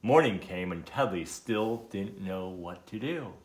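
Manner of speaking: plain reading voice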